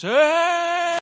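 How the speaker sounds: high, loud shouted hey; banshee yell. Retold or imitated. Father yelling hey at his son across the road. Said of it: "yelling at me like a banshee".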